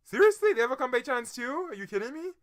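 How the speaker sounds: high, loud incredulous squeal; can't believe the repeat town; shocked disbelief. Real, not acted. Reacting to a quiz answer, shocked disbelief.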